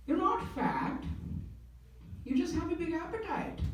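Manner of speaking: high put-on mother voice; consoling mom. Single Indian mom to son called fat, comically consoling. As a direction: play Mom reassuring her child, pitched well up. Joke voicing his mom's consolation.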